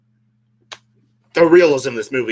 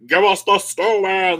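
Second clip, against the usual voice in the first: slow, higher-pitched psychopath imitation; lightly hammed-up crazed demand; mock psychopath; 5 semitones higher, slower than usual. Imitating the man chasing her, mock psychopath. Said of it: "like a psychopath".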